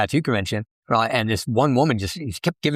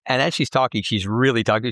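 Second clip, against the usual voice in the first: usual speaking voice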